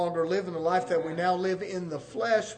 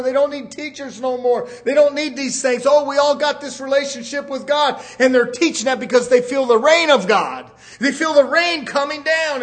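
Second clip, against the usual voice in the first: forceful, urgent sermon; preaching; 4 semitones higher, 7 dB louder than usual. Real, not acted. Preacher condemning divorce in America, forceful preaching. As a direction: hammer the warning at the congregation.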